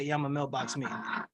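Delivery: low, soft, unenthusiastic mumble of the line; mock the half-hearted participant; imitating a weak take. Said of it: "gotta say it with that beautiful energy".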